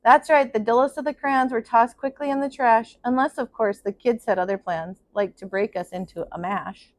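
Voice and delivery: put-on steady storyteller reading to children; story narration. A narrator's reading. Teacher reading a picture book to her class, in a gentle storytelling voice. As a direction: read it out like circle time for kids.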